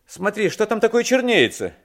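high, loud urgent call; shouted question. A narrator's reading. Passenger shows coachman a dark shape far away, shouting. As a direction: call out high to point something out. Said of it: "закричал я".

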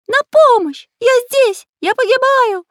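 very high, loud squeaky cries; shrieking parrot. A narrator's reading. Parrot on roof faking pencil's peril, shrieking. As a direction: squawk as if in mortal danger. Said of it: "кричал попугай".

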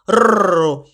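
short rolled-r growl; tiger growl. Acted. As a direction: roll out a pretend tiger growl.

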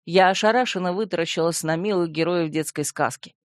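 plain reading voice

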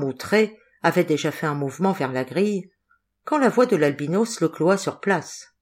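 ordinary delivery

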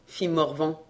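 ordinary delivery, nothing marked

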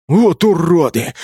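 huffing in indignation; puffing berserker. A narrator's reading. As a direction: curse while puffing from the run. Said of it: "совсем рядом пропыхтел берсерк".

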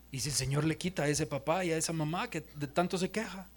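low, soft, with long pauses; solemn hush. Acted. Preacher imagining God taking a parent away, solemn hush. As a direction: let each sobering thought sink in quietly. Models a solemn prayer, then sobering questions.

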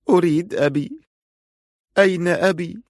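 put-on high, pleading tearful girl; tearful murmur. A narrator's reading. Crying girl asking for her father, high tearful plea. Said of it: "سقطت الدموع بلا توقف وهي تهمس".

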